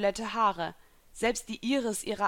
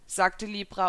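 plain reading voice

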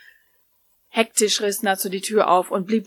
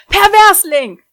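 shrieked insult, much higher and louder; scolding shriek; 11 semitones higher, 13 dB louder, slower than usual. Acted. Lucy shrieking at Natsu who caught her half-naked. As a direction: shriek it at him in outrage. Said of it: "schimpfte sie".

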